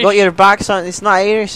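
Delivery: playing a Scottish soldier, a bit overdone; mock Scottish accent. Player putting on a Scottish accent for his squad. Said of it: "everybody's Irish".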